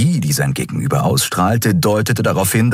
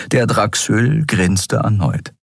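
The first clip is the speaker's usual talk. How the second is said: ordinary talk, nothing marked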